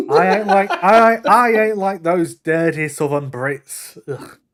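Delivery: a loud, slow, proud Northern English lad; Northern English accent. Acted. Mocking Southern Brits to a friend, loud slow Northern English accent. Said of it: "Northern English".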